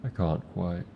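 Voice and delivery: normal talking voice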